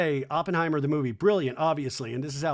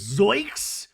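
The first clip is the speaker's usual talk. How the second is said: mock cartoon shock; 4 semitones higher than usual. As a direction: blurt it out like a cartoon double take. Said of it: "Mary Cosby's face goes".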